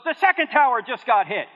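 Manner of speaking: a pastor yelling down the phone; retold scream. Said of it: "he screams into the phone".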